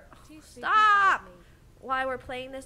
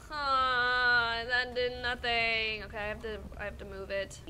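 usual speaking voice

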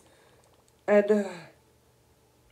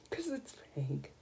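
whispered in awe; 7 semitones lower, 14 dB quieter than usual. Real, not acted. Gamer whispering about the pink bench. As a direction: breathe it out reverently.

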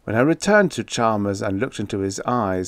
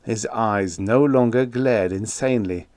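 plain reading voice

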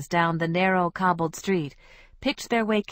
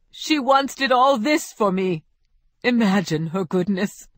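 dolorous; 2 semitones higher, 4 dB louder than usual. Acted. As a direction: grieve over the paint as if she were a saint. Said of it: "saying in a dolorous voice".

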